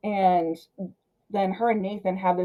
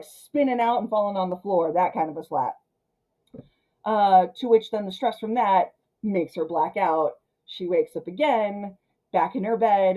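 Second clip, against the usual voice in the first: ordinary talk, nothing marked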